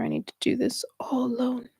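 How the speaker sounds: soft unvoiced whisper; whispered defiance. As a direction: murmur it stubbornly to yourself. Ego voice trailing off.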